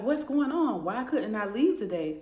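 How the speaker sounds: high, loud confronting shout; alarmed demand. Acted. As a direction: demand answers at the door, voice up high. Confronts boyfriend at the door.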